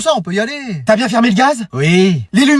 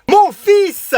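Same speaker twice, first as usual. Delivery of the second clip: excited announcement; 9 semitones higher, 3 dB louder than usual. Acted. Mock award ceremony announcement.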